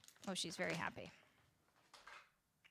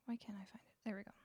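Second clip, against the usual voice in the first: unvoiced whisper to self; 14 dB quieter, much faster than usual. Real, not acted. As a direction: mutter it in a whisper. Whispered muttering.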